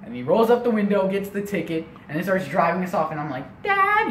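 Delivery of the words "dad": high, loud squeak for dad; twelve-year-old boy. Retold or imitated. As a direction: call dad in a child's falsetto. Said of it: "I'm like this twelve-year-old boy".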